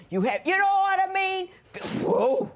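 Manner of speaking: excited outburst. Real, not acted. Judge gets carried away.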